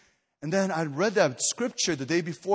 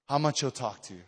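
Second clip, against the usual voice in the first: ordinary talk, nothing marked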